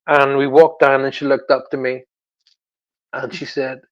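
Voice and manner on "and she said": ordinary delivery, nothing marked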